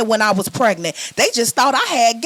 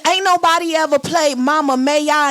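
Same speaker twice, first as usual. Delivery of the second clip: normal talking voice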